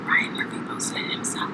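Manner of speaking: whispered question; whispering. Acted. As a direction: whisper it in disbelief. ASMR segment question.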